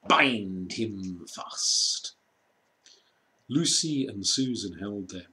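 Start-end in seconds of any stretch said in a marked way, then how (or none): 0.1-2.1 slow, gloating witch command. A narrator's reading. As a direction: savour each word like a cruel queen. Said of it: "she cried".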